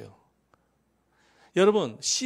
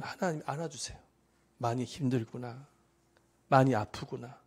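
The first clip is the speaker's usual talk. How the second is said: soft, lower-pitched sympathy; tender comforting; 5 semitones lower, 4 dB quieter than usual. Retold or imitated. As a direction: voice God gently consoling someone hurting. God voiced comforting the hurting.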